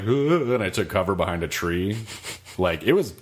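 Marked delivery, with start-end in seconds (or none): 0.0-0.5 groan. Retold or imitated. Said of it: "I was like".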